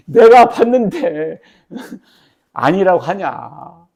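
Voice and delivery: loud, high, very drawn-out protest; hammed-up incredulous eyewitness; acted indignant challenge. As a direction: call out the liar with slow, mock outrage. Acts out witness challenging Peter's denial.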